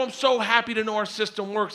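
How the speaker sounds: high, giddy mock relief; imitated hysterical giddiness. Refused customer to arms dealer, mock giddy hysterics. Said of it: "I looked at him laughing hysterically".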